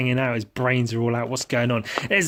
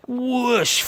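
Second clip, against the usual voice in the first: whoosh noise; 12 semitones higher, 3 dB louder than usual. Pretend. Swooshing sound effect.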